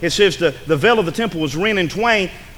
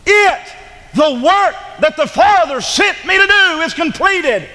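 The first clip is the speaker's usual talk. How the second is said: high, loud, slow proclamation; triumphant shout; 12 semitones higher, 5 dB louder, much slower than usual. Acted. Preacher voicing Jesus's cry from the cross, shouting in triumph. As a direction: cry it out in victory. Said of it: "He said".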